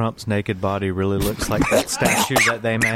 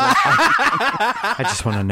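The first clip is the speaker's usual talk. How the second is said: mock high-voiced question; 13 semitones higher, 5 dB louder, much slower than usual. Acted high voice in sketch.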